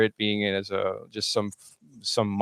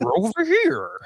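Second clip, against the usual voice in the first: squeaky cartoon voice; 14 semitones higher, 3 dB louder than usual. Joins in the squeaky cartoon bit.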